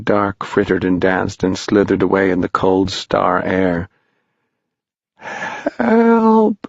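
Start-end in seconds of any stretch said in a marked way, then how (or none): 5.2-6.7 high, drawn-out distant wail; fading wail. A narrator's reading. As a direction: wail it high like a child being carried away. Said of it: "wailed a fading voice".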